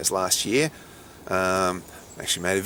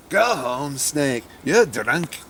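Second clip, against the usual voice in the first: put-on Scottish accent, pitched up; mock Scottish scolding, louder on the end; fake Scottish accent; 4 semitones higher, 4 dB louder, much slower than usual. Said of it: "I did that in a fake Scottish accent".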